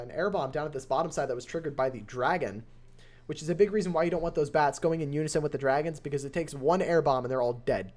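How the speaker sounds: ordinary delivery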